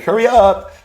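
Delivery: put-on high, puzzled little boy voice; little kid voice. Young grandson asking grandpa what's happening, high childish voice. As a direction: sound like a small confused child. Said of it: "I'm, like, little".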